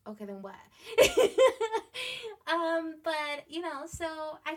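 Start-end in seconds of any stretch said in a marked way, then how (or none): 0.6-2.4 high laugh. Real, not acted.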